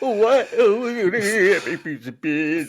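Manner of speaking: high, loud, slow hammed Cockney; Cockney accent. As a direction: stretch it out in bad Cockney. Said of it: "famous for doing a worse Cockney accent than you".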